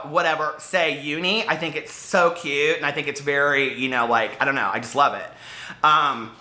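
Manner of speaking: ordinary delivery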